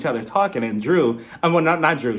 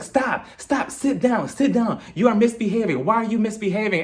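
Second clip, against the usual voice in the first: tag-light scolding; 5 semitones higher than usual. Retold or imitated. Mother scolding a child, retold.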